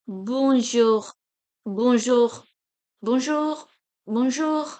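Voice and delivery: bonjour repeated loudly, final R sounded; French final R. Shown as a demonstration. Teacher showing last letter R, bonjour with R sounded. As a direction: say bonjour with French zh and throaty ending. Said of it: "pronouncing the last letter R".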